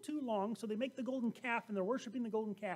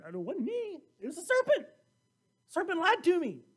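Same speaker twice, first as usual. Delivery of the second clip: higher, louder, squirming out of blame; defensive Eve; 7 semitones higher, 6 dB louder than usual. Acted. Eve answering God, higher and loudly defensive. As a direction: whine that it was the serpent.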